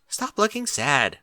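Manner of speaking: ordinary delivery, nothing marked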